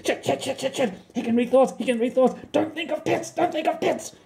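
high, anxious frantic repetition; trembling panic. Acted. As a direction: babble in panic. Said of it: "trembling all over and sweating profusely".

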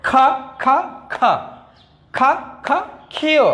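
high, even খা beats, then falling ক্ষীয়; sounding out aloud. Shown as a demonstration. Teacher has pupils practise along, sounding out aloud. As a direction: call out খা in beats, finish on ক্ষীয়. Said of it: "আওয়াজ করে যদি বলি".

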